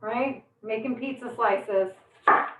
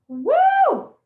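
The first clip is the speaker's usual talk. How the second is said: a sharp whoop, much higher and louder; high woo; 7 dB louder than usual. Real, not acted. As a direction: whoop from the onion sting. Onion fumes again.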